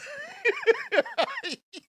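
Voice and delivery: high burst of laughter; cracking up at the comment; big laugh. Real, not acted. Host cracks up reading a viewer's joke, loud laugh.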